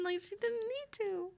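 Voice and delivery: high, slow indignant protest. Real, not acted. Indignant comment.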